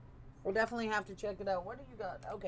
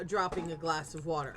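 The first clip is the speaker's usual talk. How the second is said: normal talking voice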